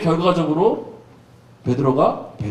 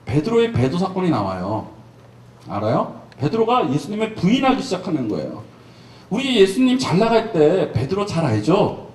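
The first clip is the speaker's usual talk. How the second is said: ordinary talk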